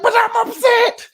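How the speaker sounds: high, loud retold shout; hammed-up indignant yell; upset protest. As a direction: shout back in exasperation. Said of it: "you're getting upset again".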